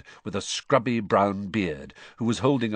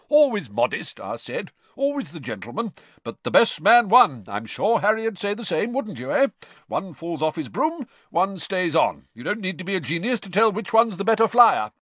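higher, loud, jovial dad voice; hearty bragging on your son's behalf; boastful, genial father; 10 semitones higher, 6 dB louder than usual. A narrator's reading. Amos slapping his son's back, loudly and genially boasting.

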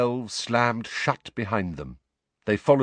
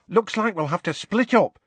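high, brisk organiser; 11 semitones higher than usual. A narrator's reading. Tonks looking around for empty chairs, briskly. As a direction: take charge briskly, pitched up. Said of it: "said Tonks briskly".

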